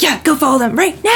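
a playful dog talking. Retold or imitated. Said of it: "Goose is like".

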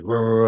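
rolling bark-like roar; imitated dog growl. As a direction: mimic a charging dog. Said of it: "this pit bull comes running up".

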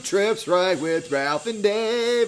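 loud, slow announcer welcome; big show-opening voice; hyped podcast intro. Acted.

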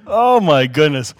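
ordinary talk

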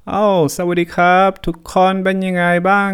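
high, fast, breezy greeting in Thai; overly casual hello to the police; play it cool mode. Retold or imitated. Playing it cool, greeting eight police officers in Thai, high and breezy. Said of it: "So I go and I'm like".

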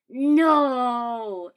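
loud, high, drawn-out cry of horror; horrified holler. A narrator's reading. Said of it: "sounding absolutely horrified".

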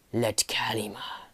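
breathy unvoiced whisper. A narrator's reading. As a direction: whisper it from above. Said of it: "whispered the stranger from above".

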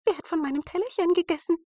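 hohe, schnelle Zwergenstimme, WER betont; empört hoch fragen; zweiter Zwerg. A narrator's reading. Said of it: "Der zweite". (hohe Zwergenstimme, eilig)

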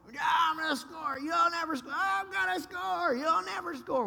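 imitating a childish yelling match; mock shouting match. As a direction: trade the taunts like two brothers. Acted boyhood back-and-forth.

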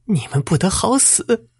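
high, slow shrieked curse; desperate scream. A narrator's reading. Teacher's wife cursing the bandits before her death, high shriek. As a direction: hurl the curse at them with all she has. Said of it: "朱老师的妻子大喊一声".

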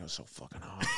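put-on breathy whisper of the girlfriend; whispered girlfriend quote. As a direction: whisper it, swooning. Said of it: "She was like".

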